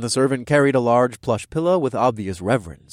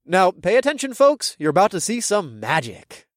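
showman voice; 7 semitones higher, 3 dB louder than usual. A narrator's reading. Hero showboats to the crowd.

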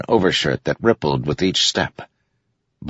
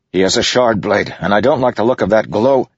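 higher, pressing argument; urgent warning; 5 semitones higher, 3 dB louder than usual. A narrator's reading. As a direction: warn your father urgently. Adolin warns father about assassin.

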